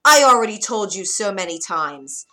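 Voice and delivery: loud, exasperated scolding; shouted reprimand, pitch near normal; witch shouting back. A narrator's reading. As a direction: scold a child at full volume. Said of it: "the witch shouted back".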